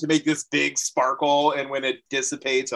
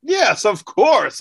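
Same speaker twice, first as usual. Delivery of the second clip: grand bard reply; 10 semitones higher, 7 dB louder, slower than usual. Acted. Bard answers owner grandly.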